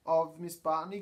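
ordinary delivery

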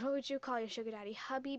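normal talking voice

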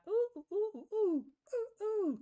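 high, stretched monkey hooting; playful ape hoots. Pretend. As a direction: hoot like an ape, high and slow.

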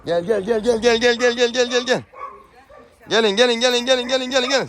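high, steady coaxing call. Real, not acted. Owner coaxing the birds to come over, high repeated call. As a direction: lure the animals over with repeated calls.

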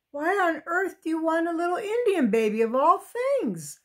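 put-on voice of a baffled mother; exasperated mother. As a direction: scold a child in baffled disbelief. Mother scolding her child.

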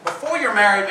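ordinary talk

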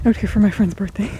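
ordinary talk

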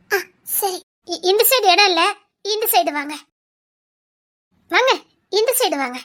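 ordinary delivery

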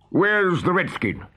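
high, slow captain's demand; Hook demanding. A narrator's reading. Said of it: "he demanded".

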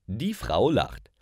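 normal talking voice

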